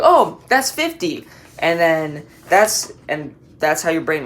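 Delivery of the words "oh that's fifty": put-on high, slow aha voice of a kid remembering; a lightly voiced kid who suddenly gets it; drawn-out eureka in a higher voice; realizing kid. Kid recalling the answer aloud.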